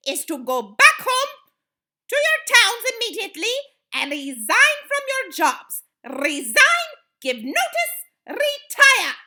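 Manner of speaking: loud, high barked orders; thundered. A narrator's reading. Grand High Witch ordering witches home, thundered. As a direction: thunder out each instruction. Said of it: "thundered the Grand High Witch".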